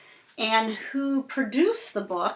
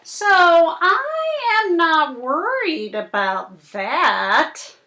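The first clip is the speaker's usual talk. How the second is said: slow, dramatic picture-book reading; reading to children; 3 semitones higher, 8 dB louder, much slower than usual. Acted. Reading the fish story to Cooper, slow and dramatic. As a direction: draw the little listener into the story.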